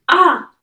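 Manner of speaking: loud high startled ah; startled gasp. Acted. Lorella startled to hear Louis, loud high gasp. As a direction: jump at the sound of his voice. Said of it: "she turns around and is like".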